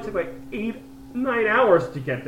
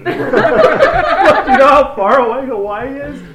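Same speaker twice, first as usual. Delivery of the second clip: high, loud words bursting through laughter; can barely get it out for laughing; laughing hard; 9 semitones higher, 12 dB louder, much slower than usual. Real, not acted. Laughing at his girlfriend thinking Hawaii is close, loud and high.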